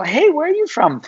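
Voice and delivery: put-on light up at hearing your home accent; excited greeting abroad. Traveller hails a stranger with his accent, excitedly. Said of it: "you go".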